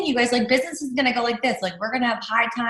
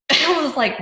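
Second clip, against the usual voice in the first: ordinary talk, nothing marked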